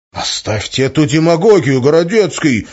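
high, loud sudden cry; thin shrill outcry. A narrator's reading. Young idealist snaps at his mentor, high loud outcry. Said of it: "неожиданно тонко выкрикнул Валентин".

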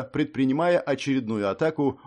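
plain reading voice

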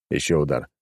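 ordinary delivery

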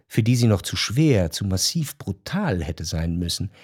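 ordinary talk, nothing marked

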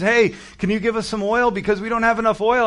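lightly voiced begging bridesmaids; pleading foolish virgins. Foolish virgins asking the wise ones for oil, pleading and high.

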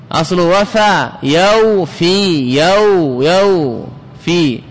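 يوفي slowly split as yaw-fī; original و sounded. Shown as a demonstration. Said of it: "الواو وقعت بين عدوتيها".

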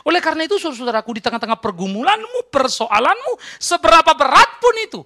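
high, loud exhortation building to a peak; rally the congregation with rising force; full-force preaching. Real, not acted. (khotbah berapi-api bernada tinggi)

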